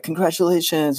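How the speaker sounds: pitched high up, bright congratulating cheer; mock congratulations. Friends cheering the bartender's Facebook tag, high mock cheer. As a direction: cheer him like he won an award. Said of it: "all his friends are like".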